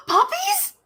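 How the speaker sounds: gasping delight. Real, not acted. Gasps at the thought.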